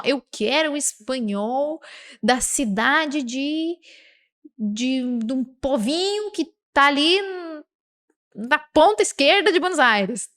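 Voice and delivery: drawn-out, hesitating mock request; overly specific request. Teacher playing a student's overly specific wish, drawn-out mock fussiness. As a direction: stretch the words, groping for ever narrower detail. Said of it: "não precisa ser assim".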